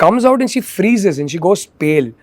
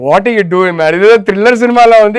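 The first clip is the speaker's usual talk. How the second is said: mock exasperation; 2 semitones higher, 4 dB louder, much faster than usual. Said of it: "I was like".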